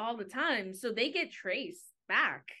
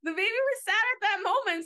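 high, loud protest; amused insistence; 11 semitones higher, 6 dB louder than usual. Real, not acted. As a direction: insist loudly, pitched way up. Insists over the laughter.

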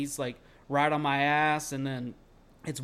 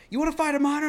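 high, loud goading; acted-out combative holler; retold shouted taunt; 13 semitones higher, 7 dB louder, faster than usual. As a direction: yell a dare, replaying the standoff. Retold shouted dare to attacker.

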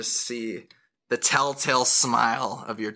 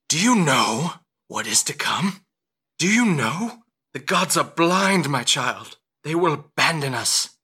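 loud, swooping ominous questioning; dire warning; 5 semitones higher, 5 dB louder than usual. Acted. Patron warning a mother of what is coming, dire. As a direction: proclaim doom with rising intensity.

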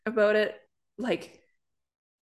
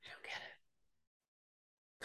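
whisper; 25 dB quieter, much slower than usual. Real, not acted. Repeats line as whisper.

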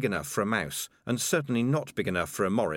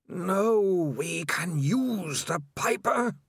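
slow, eerie, windy otherworldly voice; rushing wind voice; 8 semitones higher, much slower than usual. Acted. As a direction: breathe it like wind with a groan. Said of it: "The next voice came from everywhere".

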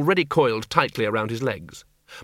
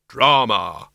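grim reaper voice; 2 semitones lower, 4 dB louder than usual. Acted. Death answers.

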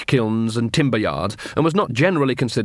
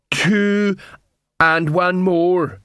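slow, raised-pitch troll counting; slow-witted troll; 7 semitones higher, much slower than usual. Acted. Troll tries counting the dwarf's fingers, slow and dull-witted.

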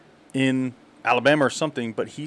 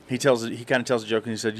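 ordinary talk, nothing marked

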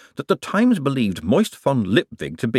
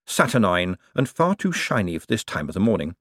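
ordinary delivery

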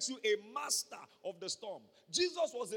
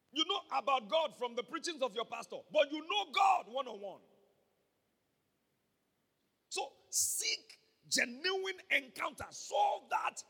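louder, higher fervent preaching; preaching shout; 6 semitones higher, 6 dB louder than usual. Real, not acted. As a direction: drive it home with full force.

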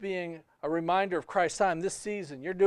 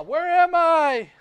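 put-on playful child; 8 semitones higher, 5 dB louder, slower than usual. Child hiding under blanket.

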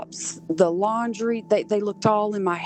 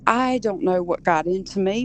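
ordinary talk

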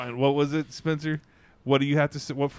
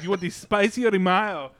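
louder, higher imitated Mexican accent; thick Mexican accent; 5 semitones higher, 5 dB louder than usual. Repeating the question to a baffled customer, thick Mexican accent. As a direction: push the Mexican accent harder. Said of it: "the guy has a thick accent".

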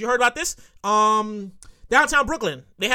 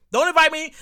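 ordinary talk

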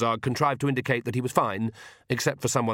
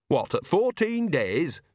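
doubtful; 7 semitones higher, slower than usual. A narrator's reading. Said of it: "said Nanny doubtfully".

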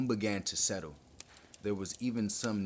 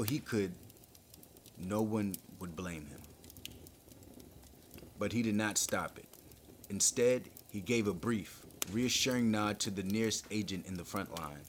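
usual speaking voice

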